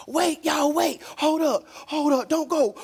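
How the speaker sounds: loud, panicky begging; hammed-up plea to wait; imitating a man begging them to stay; mock pleading.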